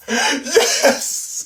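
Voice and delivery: high joyful yes with a laugh; excited cheer. Real, not acted. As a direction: cheer like your wish came true. Cheering at the reveal.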